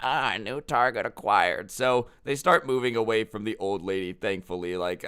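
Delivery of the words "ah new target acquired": slow, put-on raptor voice; drawn-out mock predator voice; velociraptor impression. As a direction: play a hunting raptor locking onto prey. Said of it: "the velociraptors hear a sound".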